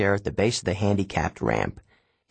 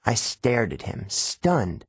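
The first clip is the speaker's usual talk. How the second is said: plain reading voice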